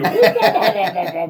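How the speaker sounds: rapid loud whoops through laughter; imitated whoop noises. Storyteller mimicking a vacuum demo, laughing whoops.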